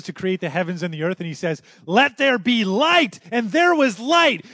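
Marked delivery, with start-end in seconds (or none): 1.9-4.4 high, loud, slow pulpit proclamation; loud, high preaching climax; full-force preaching. Acted. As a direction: proclaim it to the back row. Climax of sermon.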